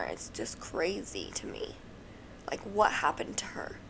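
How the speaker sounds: hushed, almost breathless whisper; soft unvoiced murmur. Real, not acted. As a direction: drop to a whisper over the sad part. Sad missing person story.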